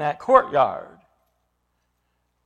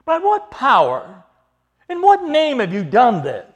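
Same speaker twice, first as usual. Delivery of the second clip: loud, high-pitched challenge; demanding question; 9 semitones higher, 9 dB louder than usual. Acted. Chief priests questioning the apostles, loud high demand. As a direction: thunder the question at the accused. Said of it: "and they ask this question".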